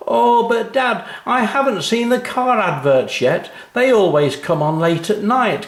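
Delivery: put-on high, whiny child pleading with dad; complaining child. A narrator's reading. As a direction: plead to stay up, a touch whiny. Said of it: "complained Anna".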